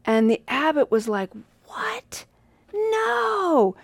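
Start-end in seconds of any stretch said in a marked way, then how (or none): 1.7-3.8 high gasp then loud alarmed no; shocked. Retold or imitated. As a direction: react in sudden dismay. Abbot hears the monk left.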